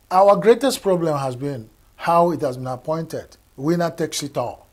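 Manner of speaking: ordinary talk, nothing marked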